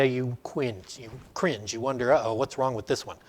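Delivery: a hushed uh-oh of mock dread. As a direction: cringe and whisper worry to yourself. Dreading a German theologian's idea.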